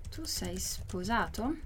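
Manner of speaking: soft, slow question for learners; careful, hushed repeat-after-me question; slow model reading. Shown as a demonstration. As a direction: draw out each word quietly, rising at the end. Teacher repeats it slowly for learners.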